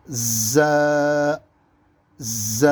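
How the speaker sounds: ز with a long hard z buzz before the vowel; forceful buzzed ز. Shown as a demonstration. Teacher demonstrating the letter, ز strongly buzzed. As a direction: buzz ز like a skidding car. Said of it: "آواز بالکل زور سے نکلے گی".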